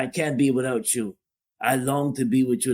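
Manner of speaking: ordinary delivery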